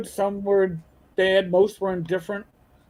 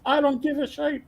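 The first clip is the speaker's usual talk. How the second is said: high dismissive Irish brogue; 7 semitones higher than usual. Retold or imitated. Old CO brushing off his excuse, high Irish brogue. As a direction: toss it off like a gruff Irish boss.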